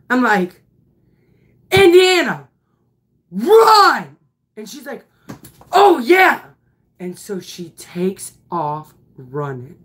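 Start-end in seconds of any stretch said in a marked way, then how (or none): 1.7-4.2 high, loud urgent yell; shouting to run. Retold or imitated. Coach yelling at the batter to run, loud. 5.7-6.4 high, loud startled oh yeah; sudden realization. Retold or imitated. Batter suddenly realizing she should run, surprised.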